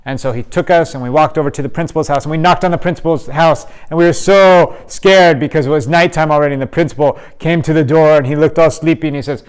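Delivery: normal talking voice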